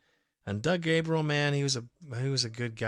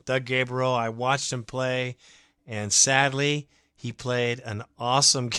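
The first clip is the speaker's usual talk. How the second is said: ordinary talk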